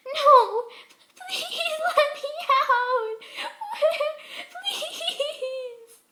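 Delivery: shrieking, very high and loud, half sobbing; crying in panic. A narrator's reading. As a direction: beg to be let out in sheer terror. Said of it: "Crying, she threw herself".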